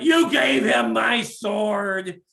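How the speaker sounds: slow, loud angry shout; furious father. Acted. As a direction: shout it out word by word. Father furious over the sword.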